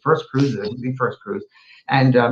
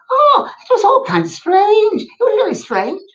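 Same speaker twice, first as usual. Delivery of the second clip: Scottish woman; 4 dB louder than usual. Retold or imitated. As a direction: put on a high Scottish lady voice, amused. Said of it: "it was a Scottish woman".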